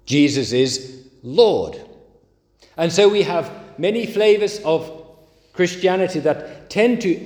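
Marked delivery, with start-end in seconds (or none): 0.0-1.8 slow, with the emphasis landing on Lord; pause, then lean heavily on Lord; stress on LORD. Shown as a demonstration. Preacher showing the misappropriated creed, stress on Lord. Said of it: "putting the emphasis on the lo-, wrong word".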